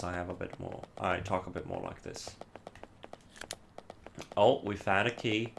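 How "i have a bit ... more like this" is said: softer, relaxed natural voice. Shown as a demonstration. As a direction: let the voice drop and relax. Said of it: "have my voice a lot more relaxed".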